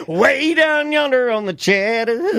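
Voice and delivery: Shaggy voice. Shown as a demonstration. Trying the Shaggy voice.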